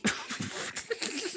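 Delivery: soft airy chuckle; quiet amused breath laugh; breathy unvoiced laugh. Real, not acted. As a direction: laugh under the breath at the card. Laughing at jumping card.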